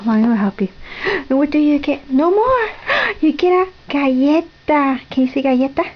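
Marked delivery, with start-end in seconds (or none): 1.0-5.0 high sing-song with a gasp; excited build-up. Real, not acted. Builds up toddler's treat.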